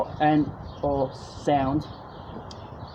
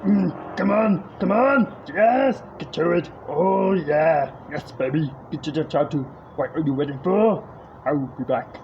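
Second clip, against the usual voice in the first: Arnold Schwarzenegger; 3 dB louder than usual. Retold or imitated. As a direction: growl it out like a bodybuilder star. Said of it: "Arnold Schwarzenegger".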